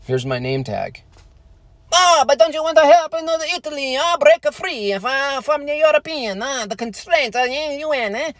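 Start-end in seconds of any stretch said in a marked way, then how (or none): 1.9-8.3 much higher, cartoonish Italian accent; mock Italian accent. Mocking Italian-heritage pride, in a high, cartoonish Italian accent. Same Italian caricature again.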